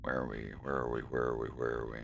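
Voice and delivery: usual speaking voice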